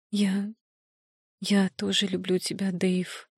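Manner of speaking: soft, unsure confession; hesitant. A narrator's reading. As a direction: falter over the first word. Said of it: "неуверенно произнесла принцесса".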